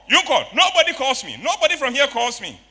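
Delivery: high, very loud angry rant; angry customer shouting. Retold or imitated. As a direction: yell the complaint in outrage. Acted angry customer complaint.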